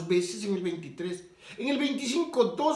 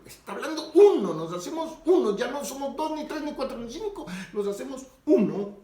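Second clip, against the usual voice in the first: loud, high preaching on unity; emphatic preaching; 4 semitones higher, 5 dB louder than usual. Real, not acted. Preacher driving home Christ's words on unity to viewers, emphatic preaching. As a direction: preach it out forcefully, punching key words.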